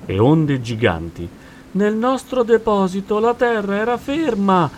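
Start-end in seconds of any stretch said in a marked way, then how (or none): 1.7-4.7 very high, loud complaint; regretful wail. A narrator's reading. Dolphin in the storm missing dry land, regretful wail. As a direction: lament shrilly, seeking agreement. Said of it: "rimpianse il delfino cercando conferma".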